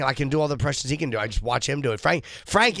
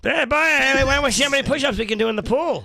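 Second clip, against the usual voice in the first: brash, pitched-up film character; Boogie Nights character; 10 semitones higher than usual. Acted. Comedian doing a requested Boogie Nights voice. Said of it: "Frank Caliendo doing Jim Norton doing John C. Reilly".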